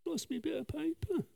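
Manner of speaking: high, soft little singsong; quiet squeaky aside to yourself; comic fretting. Pretend. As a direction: fret in a small high voice. Comic muttering over lost notes.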